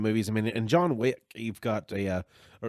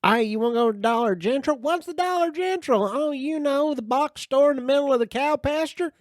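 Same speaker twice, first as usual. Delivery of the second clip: much higher, louder, fast mock villager chatter; squeaky mock locals; 6 dB louder than usual. Friends joke about rural Dollar General shoppers in high voices. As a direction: play silly yokels much higher and louder.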